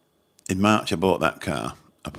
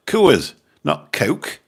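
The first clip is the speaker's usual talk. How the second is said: Coors and Coke each drawn out slowly and said louder; slow emphatic correction; 10 semitones higher, 9 dB louder, much slower than usual. Retold or imitated. Telling the waitress he ordered beer, not cola, slowly and emphatically.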